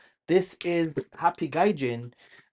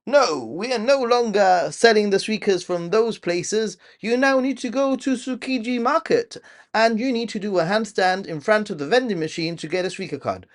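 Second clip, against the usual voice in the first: mock official announcement; 6 semitones higher, 3 dB louder than usual. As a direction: parody a bureaucrat changing the rules. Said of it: "they'll be like".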